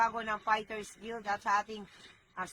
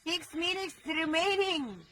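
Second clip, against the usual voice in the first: announcer call; 6 semitones higher, slower than usual. Real, not acted. Announcing the siege countdown.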